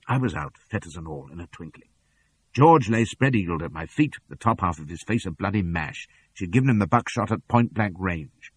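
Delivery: ordinary delivery, nothing marked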